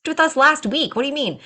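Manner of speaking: high, disbelieving mock protest; incredulous client. Doula imitates a client who just booked, incredulous. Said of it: "Or you might be like".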